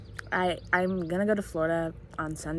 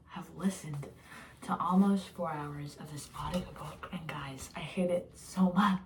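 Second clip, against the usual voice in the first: whispering; 3 semitones lower, 4 dB quieter than usual. Real, not acted. Tired late-night confession.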